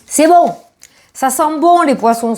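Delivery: high, pleased exclamation; delighted at good cards. Real, not acted. Reader delighted by the viewer's cards, high excited voice. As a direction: let delight lift it high. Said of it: "je parle comme ça".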